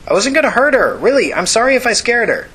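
loud, high-pitched apologetic yell; yelling back. Acted. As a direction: plead your case at a high-pitched shout. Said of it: "Tina yelled back".

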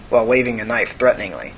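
ordinary delivery

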